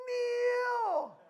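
long, high, loud wail; hammed-up cry of helpless dismay. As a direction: holler it after the vanishing car. Retold cry watching his car go.

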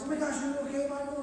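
worried wife. Retold or imitated. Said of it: "his wife goes".

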